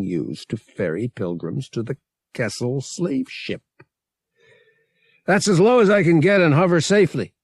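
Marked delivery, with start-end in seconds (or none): 5.3-7.3 loud, high, rapid yell. A narrator's reading. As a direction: shout it urgently to someone at a distance. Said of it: "Han yelled".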